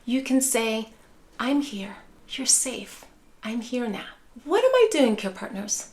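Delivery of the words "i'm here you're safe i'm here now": soft reassurance. Shown as a demonstration. Caregiver soothing an upset loved one, soft and gentle. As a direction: hush them gently like a frightened child. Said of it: "tone and rhythm of your voice".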